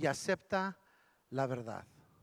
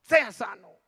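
near shout; 4 semitones higher, 6 dB louder, slower than usual. Retold or imitated. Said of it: "casi le gritó y le dijo".

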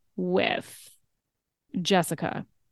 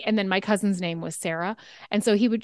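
normal talking voice

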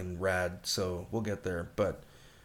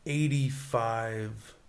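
drawn-out announcement; much slower than usual. Real, not acted. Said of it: "give this".

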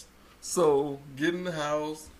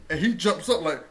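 ordinary delivery

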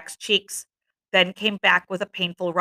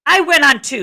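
louder, higher protest; indignant retort; 7 semitones higher, 6 dB louder than usual. A narrator's reading. Ralph answering Jack's charge of cowardice, indignant. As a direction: hit back at the accusation. Ralph argues back at Jack.

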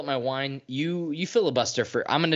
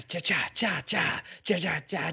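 imitated beat; 5 semitones higher than usual. Imitating car music.

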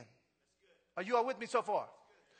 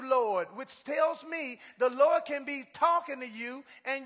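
preaching at full force; 5 semitones higher, 6 dB louder than usual. Acted. Preacher telling Saul's conversion to the church, booming. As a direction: build the story and drive it home.

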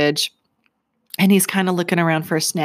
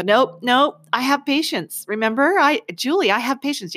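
self-coaching; 6 semitones higher than usual. Retold or imitated. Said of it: "Yes, I would talk to myself".

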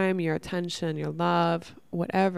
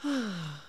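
soft sigh; 6 dB quieter than usual. Real, not acted. Moved by friend's words.

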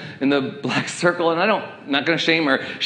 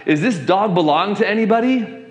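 higher-pitched call to everyone around; 7 semitones higher than usual. Retold or imitated. Pastor asking strangers about a stray dog, calling out. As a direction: ask it out loud to the whole crowd. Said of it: "I just said out loud".

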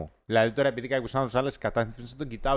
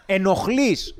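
louder, high-pitched mock telling-off; emphatic exasperated reproach; 5 semitones higher, 5 dB louder, much slower than usual. Telling off someone blocking the pavement, loudly exasperated. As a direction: punch it out as an indignant verdict. Repeats the scolding more forcefully.

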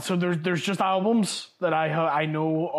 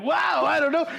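much higher, louder mock kid shout; baffled kids; 10 semitones higher, 3 dB louder than usual. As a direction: blurt it like stumped children. Kids answer the professor.

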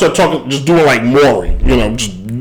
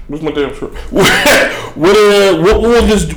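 normal talking voice

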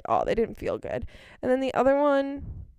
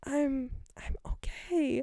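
slow, whispery mock-meek reply; hushed, hesitant; 8 dB quieter, much slower than usual. Customer answering pushy saleswoman, hushed and hesitant. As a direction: barely voice it, drawn out.

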